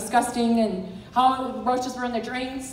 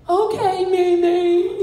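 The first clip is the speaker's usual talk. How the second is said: put-on crying child; 6 semitones higher, 5 dB louder, much slower than usual. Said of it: "she's crying".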